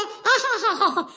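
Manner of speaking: put-on short run of childish giggles; little girl's giggle. Mute little sister answers her brother, giggling. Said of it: "ಅಂತ ನಗ್ತಾಳೆ".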